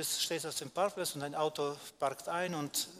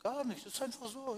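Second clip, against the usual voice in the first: higher, lightly voiced shrug of ordinary folk; mock dismissive people; 9 semitones higher than usual. People waving off the question, higher mock shrug. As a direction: toss it off as if it needs no reason. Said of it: "meistens die Leute sagen".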